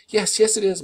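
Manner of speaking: mother answers the medics in distress; sobbing. A narrator's reading. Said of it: "Mom sobbed".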